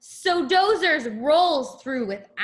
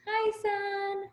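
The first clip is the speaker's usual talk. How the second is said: greeting the sun; 7 semitones higher, 8 dB quieter than usual. Acted. Trucks greet the sun in story.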